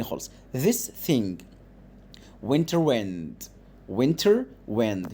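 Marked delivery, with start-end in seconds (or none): 2.5-5.1 slow, careful phonics read; slow model reading. Shown as a demonstration. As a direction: stretch each word out for the class. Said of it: "هنا شورت آي". (قراءة بطيئة وواضحة)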